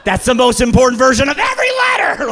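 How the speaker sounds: shouted high with mock outrage; excited shout. Real, not acted. Comic shouting to the crowd about caps lock, loud and high. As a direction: yell it like the capitals matter most.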